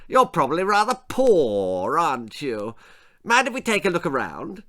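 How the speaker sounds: a haughty lord sniffing at the house; pompous lord. Acted. Lord Percy continues.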